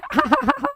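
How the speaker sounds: a quick high giggle, soft and breathy; demo laugh. Shown as a demonstration. Teacher shows the class a laugh, a quick high giggle. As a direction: giggle briefly to show what laughing is. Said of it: "हंसने को बोलते हैं".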